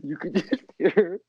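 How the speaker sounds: helpless giggles mid-story; laughing while talking. Real, not acted. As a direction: struggle to get it out through real laughter. Telling story, breaking into laughter.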